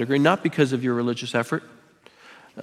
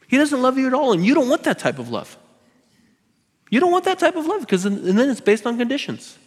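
preaching at full force; 10 semitones higher than usual. Real, not acted. Preaching climax.

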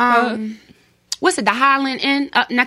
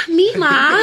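high, laughing "meemaw" to grandma; mock grandchild; 6 semitones higher, 3 dB louder than usual. Mocking kids around their grandparents, mock grandchild voice. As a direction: put on a sweet grandkid's greeting, pitched up.

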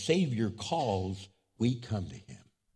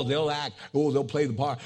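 usual speaking voice

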